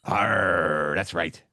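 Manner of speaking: low pirate growl; a lightly growled pirate arr. Pretend. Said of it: "let's hear you talk like a pirate".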